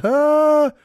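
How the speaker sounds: high, loud, drawn-out "ah"; imitated cry of delight at a fist bump; delighted exclamation. Retelling the stranger's fist bump, delighted exclamation.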